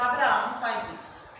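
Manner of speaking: ordinary talk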